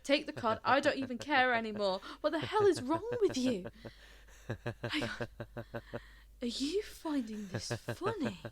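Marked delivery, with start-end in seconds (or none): 0.0-3.6 breathless rant building to loud anger; overwrought outburst. Acted. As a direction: pour it out, frantic and fed up. Overwhelmed, lashes out at stranger.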